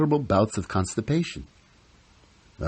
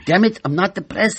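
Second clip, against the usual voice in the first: high, louder snap of denial; angry denial; 10 semitones higher, 5 dB louder than usual. Retold or imitated. Husband yelling at Sarah that he's not depressed, angry and loud. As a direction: bite back at the word depressed. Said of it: "I said".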